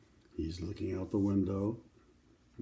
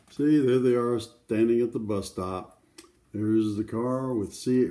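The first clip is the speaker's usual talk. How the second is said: usual speaking voice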